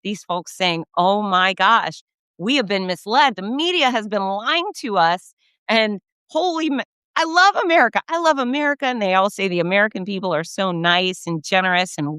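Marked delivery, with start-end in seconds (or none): 1.0-8.8 imitated gushing visitors, pitch climbing high; amazed tourists. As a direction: gush like an astonished foreign tourist, rising to an excited high. Voicing visitors amazed by America.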